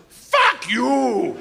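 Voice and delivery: high, drawn-out hammed-up curse; angry Russian gangster. Gangster spins the cop around, hammed-up angry cry. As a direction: hurl it out in mock fury. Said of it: "and goes".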